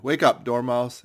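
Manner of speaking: loud, slow call; loud wake-up call. A narrator's reading. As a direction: drag him awake, loud. Rousing the sleeping Dormouse.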